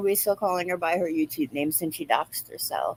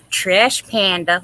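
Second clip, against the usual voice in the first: slow drawn-out insult; 8 dB louder, much slower than usual. Acted.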